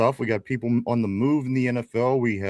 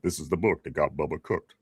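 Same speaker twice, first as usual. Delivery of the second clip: low, softer deep-voiced tagline; James Earl Jones; 7 semitones lower, 5 dB quieter than usual. Host savors the tagline once the actor is named, deep voice. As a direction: intone it deep and measured, imitating the ad. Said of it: "looks like it was, uh, James Earl Jones".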